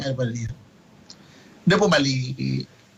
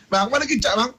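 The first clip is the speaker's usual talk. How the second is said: mock wife; 7 semitones higher than usual. Wife demanding soy sauce, mock.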